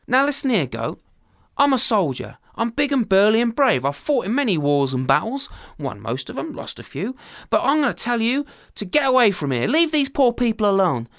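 loud, blustering orders; a burly soldier laying down the law; hammed-up barking soldier; commanding soldier.

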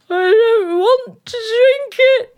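high, loud, slow crying whine; young queen whines to the king like a crying child; childish wailing refusal. Acted. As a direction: wail it like a spoiled child.

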